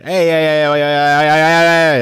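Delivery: escalating loud heys; repeated calling. Real, not acted. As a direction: call out hey hey hey as things go wrong. Calling out repeatedly at screen glitch.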